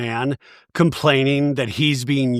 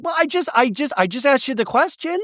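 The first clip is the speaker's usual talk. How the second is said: defensive whine; 9 semitones higher than usual. Retold or imitated. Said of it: "Brittany's like".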